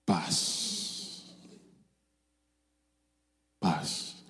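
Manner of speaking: soft hushed whisper of peace; whispered calm. Retold or imitated. Pilot hushing panicking passengers, soft whisper. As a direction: breathe the word like a lullaby.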